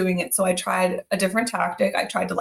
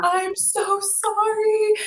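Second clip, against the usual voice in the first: put-on high, slow, tearful little-girl voice; mock-tearful child apology; imitating a crying child; 12 semitones higher, much slower than usual. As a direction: play the sorry daughter near tears. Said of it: "She started crying".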